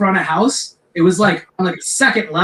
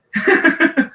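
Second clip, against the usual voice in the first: breathy laugh; 11 semitones higher than usual. Real, not acted. Laughing at himself.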